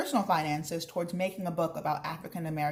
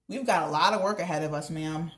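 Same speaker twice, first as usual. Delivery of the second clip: lively read-aloud with character voices; teacher reading a chapter book, voices the characters; reading aloud to children; 3 semitones higher than usual. Acted. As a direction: read the story to the class with expression. Reads chapter aloud to class.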